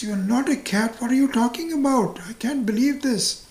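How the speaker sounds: hammed-up scolding disbelief; exasperated wife. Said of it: "And so she says".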